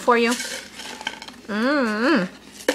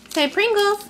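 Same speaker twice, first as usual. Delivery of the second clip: high coaxing; 8 semitones higher than usual. Real, not acted. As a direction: coax the word out with a high bright lift.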